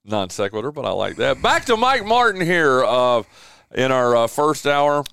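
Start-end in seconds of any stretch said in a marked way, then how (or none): 1.4-5.0 radio host. Acted. Radio host segment transition.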